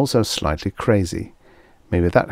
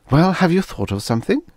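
higher woman voice; wife voice; 11 semitones higher, 3 dB louder, faster than usual. Acted. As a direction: ask as the anxious wife. Wife asks in story.

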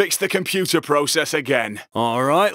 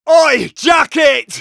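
calling out loud; 7 semitones higher, 7 dB louder, much slower than usual. Acted. Game heister calling out a teammate.

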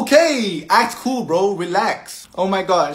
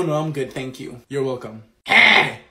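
ordinary delivery

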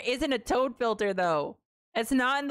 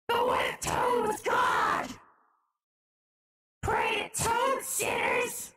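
high, slow screech through a voice filter; distorted high-pitched doomsaying; Toad as a god; 10 semitones higher, slower than usual. Pretend. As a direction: proclaim it as a shrieking filtered deity. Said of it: "how about this one, right? That's not really Toad".

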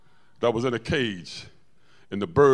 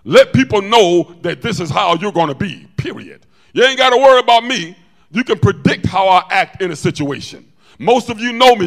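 loud, raised sermon voice; forceful preaching; 5 semitones higher, 9 dB louder than usual. Real, not acted. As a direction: declare it firmly to the whole congregation. Preaches at full force to congregation.

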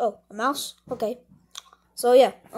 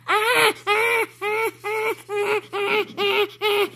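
repeated high "ah" cries; yelps of pain; 6 semitones higher, much slower than usual. Acted. Mouse caught in the trap, yelping in pain. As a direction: cry out over and over, high-pitched.

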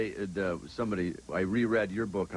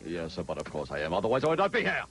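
Brian Brunschweiger voice; 3 semitones lower than usual. Acted. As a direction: start low and build to a mock-indignant finish. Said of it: "I do my Brian Brunschweiger voice".

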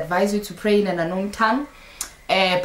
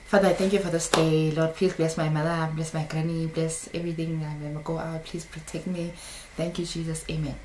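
low, soft mumbled prayer; mock perfunctory prayer; 5 semitones lower, 8 dB quieter than usual. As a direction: imitate a routine prayer under the breath. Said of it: "This is not prayer".

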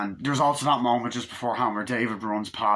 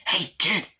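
higher-pitched call for attention; mock urgent "psst"-style call; calling out to a kid; 9 semitones higher, 3 dB louder than usual. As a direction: imitate a man calling from a bush. Naked man calls kid from bush.

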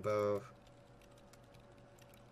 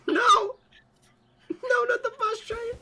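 high, loud, despairing scream; anguished scream; 7 dB louder, slower than usual. Pretend. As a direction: scream like your world just ended. Mock dramatic outcry.

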